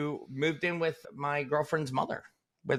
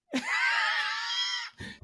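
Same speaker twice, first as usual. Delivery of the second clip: burst of laughter. Real, not acted. Laughing at own story.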